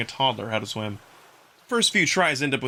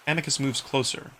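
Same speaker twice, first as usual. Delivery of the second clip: ordinary delivery, nothing marked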